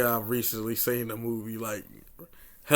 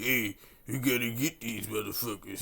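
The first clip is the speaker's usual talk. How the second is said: lightly put-on French accent; mock French colonel, a touch quieter; 2 semitones lower, 6 dB quieter than usual. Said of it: "That was my best French impersonation".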